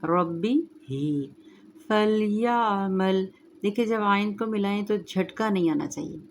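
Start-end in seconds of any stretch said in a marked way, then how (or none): 0.1-3.3 slow melodic tajweed chant; Quran recitation. Shown as a demonstration. Recites verse.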